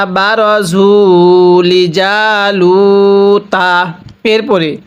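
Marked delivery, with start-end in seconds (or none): 0.1-3.7 বারজু লিজালুতা recited slowly with long vowels; slow recitation. Shown as a demonstration. Teacher joins the verse for students, slowly. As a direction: draw out every long vowel. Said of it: "তাহলে".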